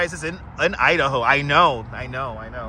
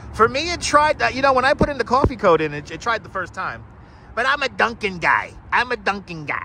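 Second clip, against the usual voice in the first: usual speaking voice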